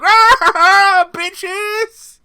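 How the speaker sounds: very high, loud shriek; mock yell. Ebony bursting in, high and loud shriek. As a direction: shriek it as a hammed entrance. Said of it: "She's like".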